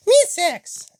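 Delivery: very high squeaky character voice; Nicole. Acted. Nicole joins the others against Jalen, squeaking high. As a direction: chime in with a falsetto pipe. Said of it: "Nicole says".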